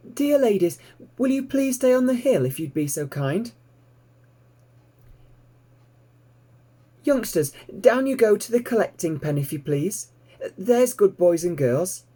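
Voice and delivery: put-on high, courteous young piglet voice; polite piglet Babe. A narrator's reading. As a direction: ask the sheep ever so nicely. Babe politely addresses sheep.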